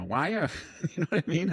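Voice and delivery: quick, high mock Fargo accent with a laugh; a playful, lightly done Minnesota Fargo accent. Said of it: "they have that Fargo accent".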